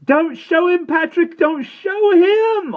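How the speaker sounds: very high, loud, drawn-out yell; desperate yell. A narrator's reading. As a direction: scream through the locked door. Omri yells through door.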